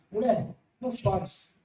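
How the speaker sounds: gentle, slow words of Jesus. Acted. Jesus comforting the grieving widow, gentle and slow. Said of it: "Com outras palavras".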